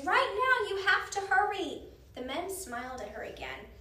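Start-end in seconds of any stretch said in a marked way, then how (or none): 0.0-1.9 high, loud rallying shout; alarmed shouted order; urgent yell. A narrator's reading. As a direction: yell a warning across the room. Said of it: "Annie yelled to the card players".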